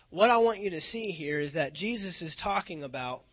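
normal talking voice

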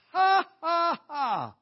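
very loud, high "ha, ha, ha"; mock laugh. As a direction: belt out a deliberate laugh, syllable by syllable. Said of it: "So we just laughed".

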